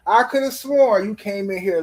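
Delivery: ordinary talk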